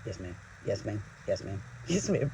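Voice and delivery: sheepish grandson being scolded; meek yes ma'am. Retold or imitated. Grandson meekly answering the scolding worker, low and soft. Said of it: "he's just going".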